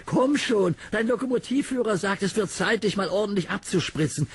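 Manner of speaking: high friendly engine voice; encouraging Thomas. Acted. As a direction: cheer up a sad friend. Narrator voices Thomas cheering up.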